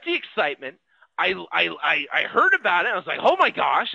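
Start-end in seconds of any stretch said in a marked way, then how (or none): 3.2-3.9 louder, bright burst of "Oh my gosh"; excited gasp. Retold or imitated. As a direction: let the thrill of the news burst out. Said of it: "I got excited about it".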